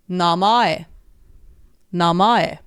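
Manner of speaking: namae as na-ma-e, ending in an i-like glide; Japanese namae. Shown as a demonstration. Teacher comparing Japanese namae with English name. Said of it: "the Japanese word for name".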